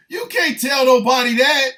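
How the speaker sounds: real laughter breaking through; laughing. Real, not acted. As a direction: squeal it while cracking up. Cracking up at own joke.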